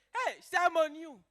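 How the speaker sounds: loud, high, slow crow at a neighbor; gloating happy mother. Acted. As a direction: call out in hammed-up triumph. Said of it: "She was very happy. She even called the neighbor".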